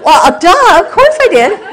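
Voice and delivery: squealed laughing duh, of course; mock indignant duh. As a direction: play it like it was obvious, cracking up. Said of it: "And I'm like".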